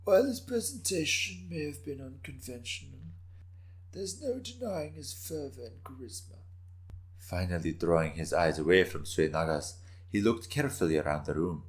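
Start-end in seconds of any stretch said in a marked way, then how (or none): 0.0-6.4 much higher, much softer voice of an ancient lord with a hint of laughter; an old man chuckling softly through his words; raspy old man, amused. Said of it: "raspy voice".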